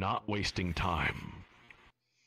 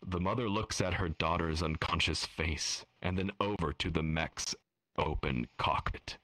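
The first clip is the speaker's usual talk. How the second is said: normal talking voice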